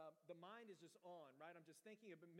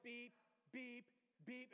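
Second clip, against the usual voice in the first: higher-pitched, insistent beeps; imitated alarm beeps; 6 semitones higher, much slower than usual. Pastor imitating the alarm after hitting okay, insistent high beeps. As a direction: mimic an alarm picking up its pace. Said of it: "it starts beeping faster".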